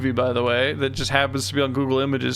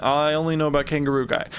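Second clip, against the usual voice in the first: normal talking voice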